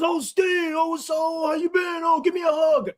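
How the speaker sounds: fake friendly guy. Old coworker greets Steve with fake warmth, high mock gushing. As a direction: ham up a phony buddy calling out. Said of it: "And he goes".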